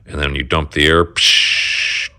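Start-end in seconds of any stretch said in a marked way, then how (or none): 1.0-2.1 air release hiss. Retold or imitated. Imitating air suspension dumping.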